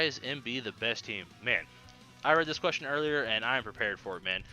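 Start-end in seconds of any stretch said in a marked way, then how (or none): none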